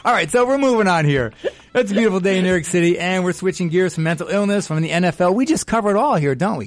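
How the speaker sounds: high, loud, fast radio host; excited host. Real, not acted. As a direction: burst back in with big energy. Upbeat radio host energy.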